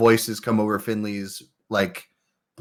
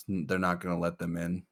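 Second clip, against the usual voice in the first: put-on low glum kid; 5 semitones lower, 14 dB quieter, faster than usual. Icarus objecting.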